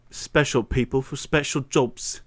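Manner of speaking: put-on slow, deliberate Cockney-style British accent. Flustered streamer demonstrating on request, slow British Cockney accent. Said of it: "a British accent would be like".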